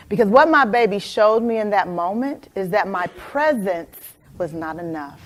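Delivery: ordinary delivery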